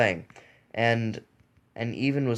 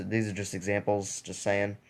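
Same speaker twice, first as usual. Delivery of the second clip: normal talking voice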